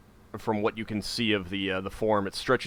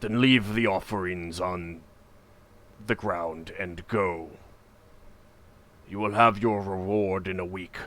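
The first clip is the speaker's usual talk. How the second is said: deep echoing voice. A narrator's reading. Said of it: "the voice then echoes, uh, out and says".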